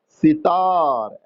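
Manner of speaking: Sitar stretched out slowly, syllable by syllable; slow dictation. Shown as a demonstration. Teacher dictates a word to the class slowly. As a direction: draw out sitar for students writing it down. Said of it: "dictating spelling to class".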